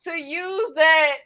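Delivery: loud, high laughing shriek; helpless high-pitched laughing; cracking up. Real, not acted. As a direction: squeal it through real laughter. Cracking up at co-host's joke.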